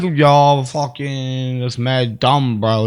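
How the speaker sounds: mock slow, dopey slang voice; mocking slow-witted street talk; dumb gangster. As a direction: drag the words out low like a dimwit. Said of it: "you sound like a fucking".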